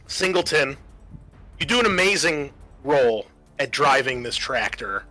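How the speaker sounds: ordinary talk, nothing marked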